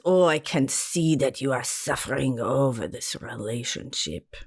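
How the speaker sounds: hammed-up Romanian palm-reader accent; a mock Romanian fortune teller reading a palm; bad Romanian accent. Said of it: "I pulled out my Romanian accent".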